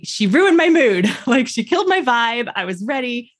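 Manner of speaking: high, half-laughing complaint; retold exasperated complaint. Mother re-enacts complaining to husband about doctor, exasperated.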